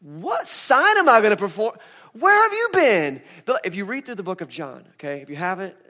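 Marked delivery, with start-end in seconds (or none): 0.0-3.2 slow, high, loud disbelief; Jesus acting offended at the question; indignant Jesus. Jesus to the doubters, slow and loud in mock outrage. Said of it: "I'll be like".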